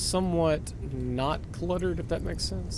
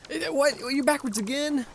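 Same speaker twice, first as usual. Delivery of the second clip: surprised; 12 semitones higher, 3 dB louder than usual. Real, not acted. Part flipped again.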